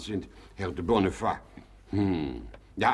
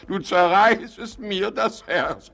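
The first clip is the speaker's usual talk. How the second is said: high, loud, slow tearful gush; sentimental sobbing; 7 semitones higher, 6 dB louder, slower than usual. Acted. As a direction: wallow in mock heartbreak. Husband sobs over wife's devotion.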